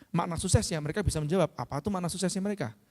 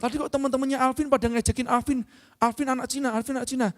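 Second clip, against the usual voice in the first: higher-pitched retold child's complaint; imitated tearful child; 6 semitones higher than usual. Son tells papa that friends teased him, imitated tearful complaint. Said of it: "dia nangis, terus dia ngomong".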